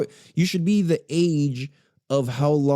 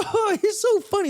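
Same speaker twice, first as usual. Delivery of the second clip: much higher, airy amused voice; mock giggly reply. People brushing off Jarrell's insult, high giggling mockery. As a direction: coo it with a mocking giggle. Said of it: "they'll be like".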